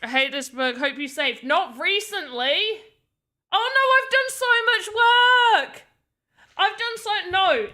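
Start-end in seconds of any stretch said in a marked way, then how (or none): 3.5-7.7 high, loud, fast outcry; panicked dismay. Real, not acted. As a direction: wail at work suddenly lost. Realises unsaved work may be lost.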